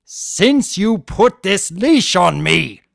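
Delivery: slow, loud, high furious roar; enraged spirit roaring every word. A narrator's reading. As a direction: bellow it in rage. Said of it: "he roared".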